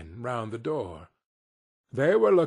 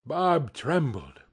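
ordinary delivery, nothing marked

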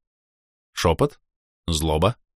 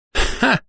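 high sharp laugh ha; short laugh. Acted. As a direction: bark out a laughing ha. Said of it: "засмеялась Кира". (короткий смешок)

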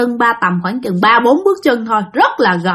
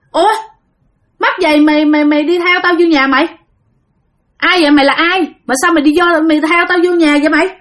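higher, mildly indignant challenge; indignant questioning; 5 semitones higher, faster than usual. Retold or imitated. Father confronting whoever followed him home, indignant. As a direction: question the stranger with rising annoyance.

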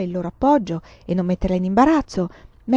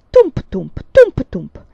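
loud rhythmic thumping; strong beat, weaker echo; bench thumps; 5 semitones higher, 12 dB louder, much slower than usual. A narrator's reading. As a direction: bang out the rocking bench in pairs. Imitated rocking bench.

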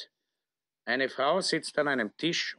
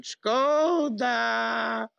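exclaiming; 9 semitones higher, 4 dB louder than usual. Acted. Old profiteer exclaims.

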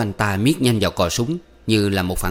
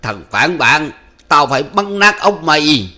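louder, higher vengeful threat; through gritted teeth; 6 semitones higher, 6 dB louder than usual. A narrator's reading. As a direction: spit it at a traitor through clenched teeth. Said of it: "nghiến răng bảo".

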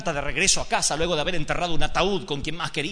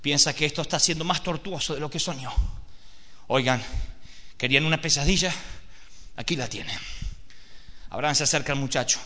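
normal talking voice

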